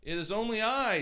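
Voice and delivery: a hint of a nervous goat; middle goat voice. A narrator's reading. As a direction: answer like the middle-sized goat. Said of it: "said the middle-sized billy goat gruff".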